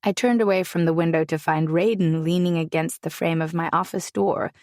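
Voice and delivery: plain reading voice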